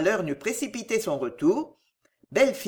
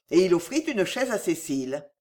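usual speaking voice

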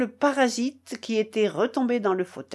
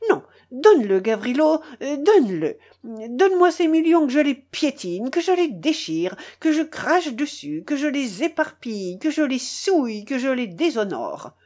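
higher, driving tirade; sudden cry; 4 semitones higher than usual. Acted. Foma snatching back the millions, crying out indignantly. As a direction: cry out in theatrical outrage, item after item. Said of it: "cria-t-il tout à coup".

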